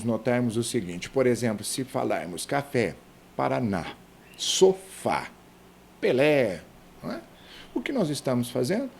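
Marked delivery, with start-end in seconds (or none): 2.5-6.6 "café, Paraná, sofá, Pelé" each punched on the final vowel; stress on last syllable. Shown as a demonstration. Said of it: "Tonicidade silábica na última sílaba". ("café, Paraná, sofá, Pelé" com a última sílaba bem marcada)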